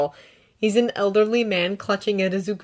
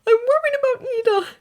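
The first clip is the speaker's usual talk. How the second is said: much higher, tearful; sniffling; 5 dB louder than usual. A narrator's reading. Izuku sniffling to All Might about Ida. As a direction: worry through sniffles. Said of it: "Izuku sniffles".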